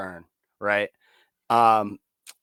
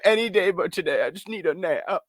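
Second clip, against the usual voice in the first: worn-out whine; 6 semitones higher than usual. Retold or imitated. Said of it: "I'm like".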